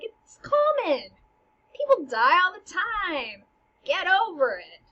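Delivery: high, slow, drawn-out mock brush-off; nonchalant queen. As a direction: shrug off death as the flippant queen. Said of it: "real nonchalant about it".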